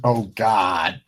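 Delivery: ordinary talk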